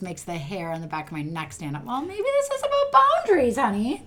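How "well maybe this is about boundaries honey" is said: much higher, fast mock-sweet voice; sweet-talking spouse. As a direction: mimic the partner's gentle tone. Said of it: "very nicely say".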